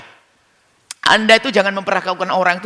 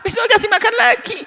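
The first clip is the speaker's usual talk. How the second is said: laughing. Retold or imitated. Laughing about feeding chicken again.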